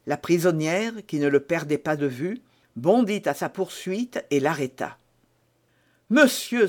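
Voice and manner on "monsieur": higher, urgent call; cry out. A narrator's reading. Milady cries out to stop Felton. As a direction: stop him at the door. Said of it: "s'écria-t-elle".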